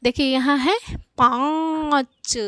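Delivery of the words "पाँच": पाँच with very long nasal आँ, separate च; nasalised vowel demo. Shown as a demonstration. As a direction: stretch the vowel of पाँच through mouth and nose. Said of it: "कैसे उच्चारित कर रहे हैं?".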